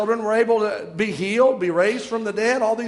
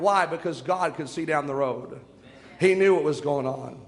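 normal talking voice

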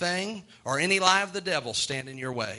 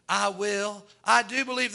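normal talking voice

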